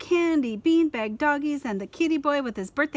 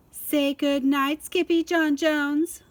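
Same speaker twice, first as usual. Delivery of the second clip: slow, louder call down the hall; mother calling her kitten goodnight; 5 semitones higher, 5 dB louder, much slower than usual. Acted. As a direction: call him to bed. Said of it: "called his mama".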